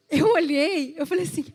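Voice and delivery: usual speaking voice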